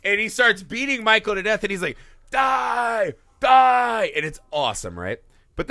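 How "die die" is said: high, loud hammed-up yell; mock shouted die. Acting out Loomis attacking Michael Myers, mock shout. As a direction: yell it while beating a killer.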